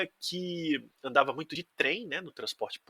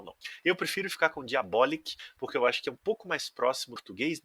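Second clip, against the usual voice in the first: ordinary talk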